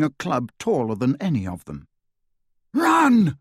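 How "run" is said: high, loud, drawn-out shouted warning; urgent cry of alarm. A narrator's reading. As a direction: yell it to scatter everyone. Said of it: "Harry shouted again".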